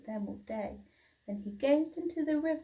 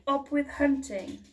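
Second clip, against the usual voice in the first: louder, higher chant; mock shout; 4 semitones higher, 6 dB louder than usual. A narrator's reading. Sisters shouting their hunting chant, louder. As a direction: chant it like a war cry. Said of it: "they shouted".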